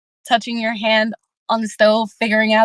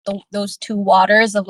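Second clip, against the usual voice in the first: normal talking voice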